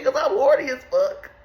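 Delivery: normal talking voice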